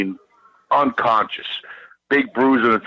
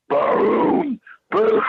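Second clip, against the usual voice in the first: mimicked booming knocks, pitched up sharply; door-pounding boom. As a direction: bang it out like a tank at the door. Imitating door being pounded.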